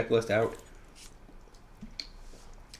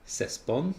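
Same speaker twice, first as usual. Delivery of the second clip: semi-Spanish accent; 2 semitones higher, 6 dB quieter than usual. Real, not acted. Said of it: "said that with a s-, semi-Spanish accent".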